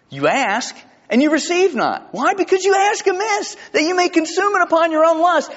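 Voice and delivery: high-pitched, exasperated scripture reading; frustrated. Acted. Preacher voices James scolding those who ask amiss, frustrated. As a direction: read it as James at the end of his patience. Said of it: "can you hear the frustration in James' voice".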